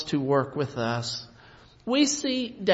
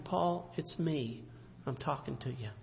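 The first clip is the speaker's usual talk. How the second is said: the Lord calls Paul softly by name; tender God; 5 semitones lower, 10 dB quieter than usual. Acted. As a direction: speak gently to the struggling Paul.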